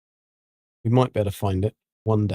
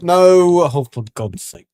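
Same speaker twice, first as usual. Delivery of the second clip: loud, higher protest falling away to usual; real exasperation; 4 semitones higher, 6 dB louder, slower than usual. Real, not acted. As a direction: cry out in frustration, then give up. Game misfires while loading world.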